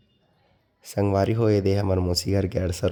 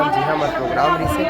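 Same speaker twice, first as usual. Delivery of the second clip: ordinary talk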